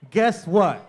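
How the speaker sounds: usual speaking voice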